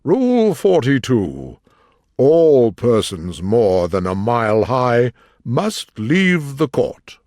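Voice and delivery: loud, slow, ponderous proclamation; reading out. A narrator's reading. King reading a rule from his book, proclaiming. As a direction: proclaim each word slowly to the room. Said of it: "read out from his book".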